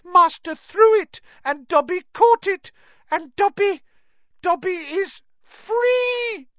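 very high, loud elf cry; Dobby overjoyed. A narrator's reading. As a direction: build to a long shouted final word. Elf realises he is freed.

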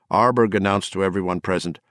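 plain reading voice

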